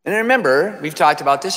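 normal talking voice

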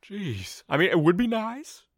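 high-pitched grumbling dragon voice; put-out dragon, voice climbing; sulking dragon. Acted. Dragon grumbles about wings.

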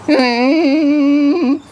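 loud squeaky hum for tomato; loud high hum. Shown as a demonstration. For the stranger, a loud high soup hum. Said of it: "show you my cream of mushroom".

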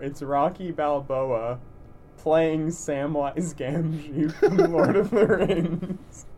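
excited announcement. Real, not acted. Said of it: "I've got the best one".

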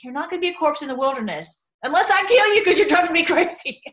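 mock threat, laughing at the end; playful exasperation; exasperated joke. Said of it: "It's like".

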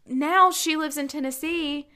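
usual speaking voice

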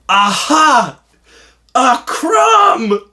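loud, high-pitched gotcha; mock triumph. Prankster uncle showing nephew a crumb, exaggerated triumphant shout. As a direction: crow it like a hammy detective.

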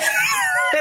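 shrieking laugh burst; high squealing laugh. Real, not acted. Laughing mid-retelling.

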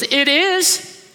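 high, loud petulant whine; mock tantrum from Jonah; sulky Jonah. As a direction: play Jonah as a sulking brat. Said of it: "And he goes".

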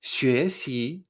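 "Xue xi" with two questioning rises; tones two-two. Shown as a demonstration. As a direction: sound surprised on each syllable of "Xue xi". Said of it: "me extraño dos veces".

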